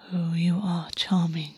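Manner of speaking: slow, soft breathy whisper to himself; whispered thought. Acted. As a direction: murmur it fondly under the breath. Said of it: "August thought".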